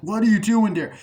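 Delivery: high, loud acted question; mock alarmed demand. Character challenging Corey as he leaves, mock alarmed demand. Acting confrontation.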